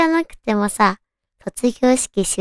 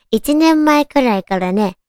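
ordinary talk, nothing marked

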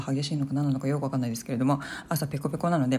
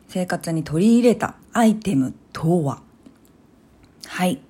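big reveal; 4 dB louder, slower than usual. Real, not acted. Grand product reveal.

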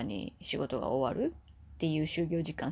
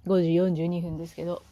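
ordinary talk, nothing marked

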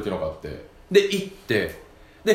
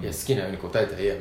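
quiet quick aside; 8 semitones lower, 7 dB quieter, much faster than usual. Retold or imitated. Father quietly replies to son.

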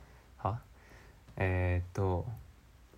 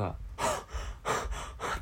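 sharp breathy gasps, no voice; mock realisation gasps; 3 semitones lower than usual. Son imitating father noticing his mistake, mock gasps. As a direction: gasp in bursts like dad suddenly realising.

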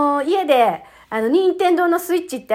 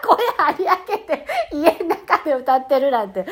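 tickled by your own silliness; real laughing; 5 semitones higher than usual. Real, not acted. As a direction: laugh while getting the words out. Laughs at her own loud singing.

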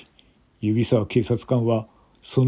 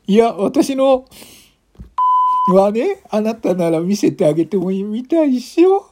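put-on dead drunk woman; 11 semitones higher, 4 dB louder than usual. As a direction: drag it out, tipsy and flirty. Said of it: "一人の泥酔した女性が".

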